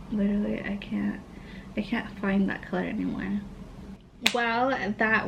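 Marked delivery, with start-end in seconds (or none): 0.1-3.4 soft, half-voiced grumble; whispered complaint. Real, not acted. Vlogger lamenting her lost lip color, whispered. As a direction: sulk about it under your breath.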